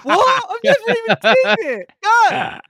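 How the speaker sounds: very high, squeaking protest through laughter; mock outrage. Dialect coach refusing impossible demands, high laughing outrage.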